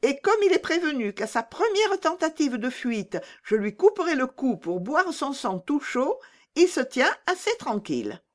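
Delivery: put-on high, bold voice of a wild girl; a gleeful little bandit bragging; fierce robber girl. Robber girl boasts of threat.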